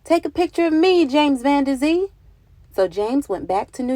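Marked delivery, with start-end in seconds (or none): 0.0-2.1 high, loud, eager call; eager townspeople. Acted. Townspeople begging James for a photo, high and loud. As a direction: call out asking for your picture. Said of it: "a line of customers saying".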